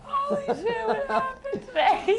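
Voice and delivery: high, laughing through the words; laughing hard. Real, not acted. Cohost cracking up at a Brooklyn impression, real laughter. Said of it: "I'm just fucking bawling over here".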